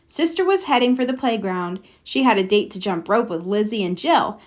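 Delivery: plain reading voice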